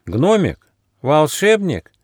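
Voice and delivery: high-pitched doubtful koala; wary little animal voice; distrustful questioning. A narrator's reading. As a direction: question each word in disbelief. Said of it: "недоверчиво спросил Коала".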